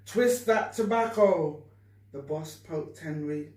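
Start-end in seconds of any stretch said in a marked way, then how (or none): 0.1-1.6 boss's order. Acted. Boss orders Henry.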